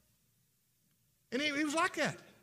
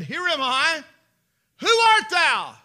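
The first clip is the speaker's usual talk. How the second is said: louder, higher questioning call; old father calling out; 10 semitones higher, 15 dB louder, slower than usual. Acted. Blind Isaac calls out loudly asking who is there. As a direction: call out to a son you cannot see.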